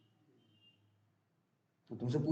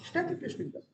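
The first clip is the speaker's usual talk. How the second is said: high soft answer; 9 semitones higher, 8 dB quieter, faster than usual. Shown as a demonstration. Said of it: "क्या कर रहे हैं?".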